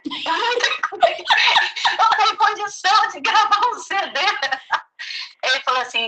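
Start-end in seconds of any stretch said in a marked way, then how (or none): 0.0-4.2 high, laughing through the words. Retold or imitated. Telling a friend how she answered the man, laughing. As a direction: laugh out the shy reply. Laughing retelling her reply.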